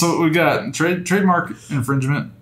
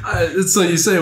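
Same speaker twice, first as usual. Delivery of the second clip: ordinary talk, nothing marked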